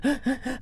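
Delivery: sharp retold gasp for air, high and breathless; choking gasp. Grieving woman gasps while breaking the news. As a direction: catch a sob in the throat. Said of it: "she sounded like she was choking".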